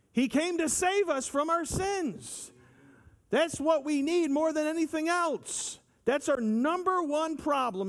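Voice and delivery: high, ringing preacher's voice; impassioned preaching. Acted. Preacher to congregation, high impassioned preaching. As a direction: lift it up like a climax from the pulpit. Preacher lifts voice on key point.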